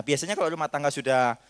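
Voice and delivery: ordinary talk, nothing marked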